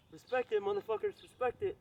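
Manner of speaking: high, excited boasting; hyped up. Real, not acted. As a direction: shout it out, pumped up.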